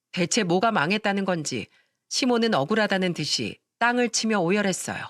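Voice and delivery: usual speaking voice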